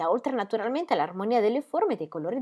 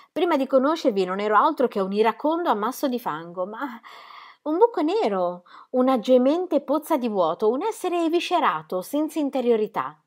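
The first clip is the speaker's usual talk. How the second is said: higher and louder, building passion; dramatic confession; 6 semitones higher, 5 dB louder than usual. Acted. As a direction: pour out his past darkness theatrically. Ingram's dramatic self-description. (confessione teatrale, più acuta e forte)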